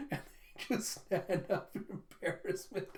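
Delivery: breathless laugh, no voice; half-whispered, giggling narration; laughing through it. Real, not acted. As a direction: laugh it out unvoiced.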